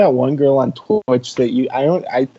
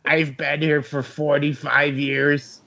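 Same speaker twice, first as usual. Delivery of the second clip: put-on slow old librarian in a Yiddish accent; drawn-out Yiddish accent, elderly; 5 dB quieter, slower than usual. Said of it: "sounds like an old librarian".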